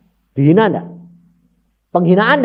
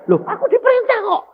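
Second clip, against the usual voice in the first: put-on soldier voice; faster than usual. Soldier voiced, defending himself.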